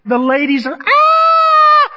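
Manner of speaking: a high, loud shriek of delight; imitating the ladies screaming for the bodybuilder. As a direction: squeal like swooning fans.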